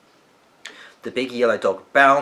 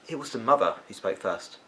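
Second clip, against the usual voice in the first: ordinary delivery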